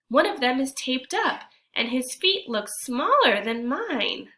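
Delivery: storytime reading voice, a touch lively; story narration. A narrator's reading. Teacher reads the picture book to kids, lively narration. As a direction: read the tale to the class.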